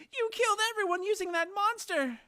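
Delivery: high, loud, screaming accusation; furious scream. Acted. Dan screams at Faye over the dead villagers, furious shout. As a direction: scream it at her with everything.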